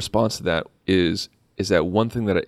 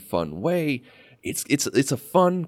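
ordinary talk

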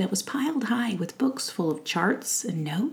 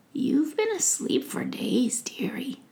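put-on tender old witch; 6 semitones higher than usual. Witch telling Faith she slept for days, tender. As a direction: soothe her gently, like a kind old witch. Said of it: "The tenderness in her voice".

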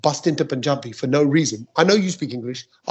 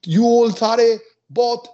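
higher, louder, slow broken Punjabi-English to the crowd; 7 semitones higher, 6 dB louder, slower than usual. Retold or imitated. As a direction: spell it out slowly in mangled Punjabi. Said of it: "Shall I say it in Punjabi?".

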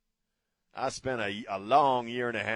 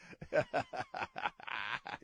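high-pitched real burst of laughter; 3 dB quieter than usual. Real, not acted. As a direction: crack up at his own joke. Laughing at own joke.

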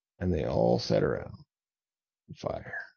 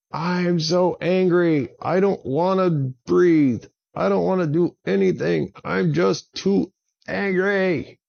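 high, loud, whiny furious dragon; angry dragon tantrum; 11 semitones higher, 7 dB louder than usual. Acted. Drago refuses Avon's advice to breathe, loud high tantrum. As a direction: throw a full tantrum, voice pitched way up.

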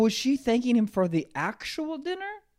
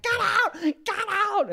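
mock furious yell; much slower than usual. Ex-girlfriend hitting him, high laughing yell. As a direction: shriek it, half laughing. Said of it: "she's like".

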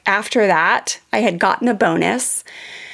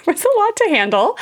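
laughing through the words, pitched up; 12 semitones higher than usual. Real, not acted. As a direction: laugh off the memory of the chaos. Laughing about overwhelming chaos.

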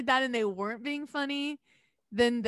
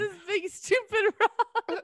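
drawn-out mockery; 10 semitones higher, much slower than usual. Repeating bit, slow high.

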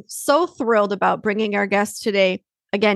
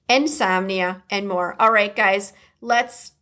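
ordinary talk, nothing marked

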